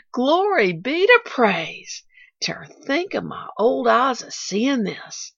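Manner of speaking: put-on slow, exalted old gardener; praising heaven for the child; Tom, rejoicing old man. A narrator's reading.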